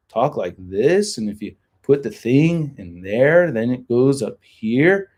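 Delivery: each phrase swooping up at the end, as on "this" and "here"; upspeak. Shown as a demonstration. Streamer demonstrating Californian upspeak, "this" and "here" rising. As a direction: lift "this", "there" and "here" like questions. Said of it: "it's like an upspeak kind of thing".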